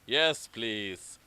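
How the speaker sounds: deep yes please; low polite guest. Acted. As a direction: rumble it like an old wizard. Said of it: "Gandalf and Strider said".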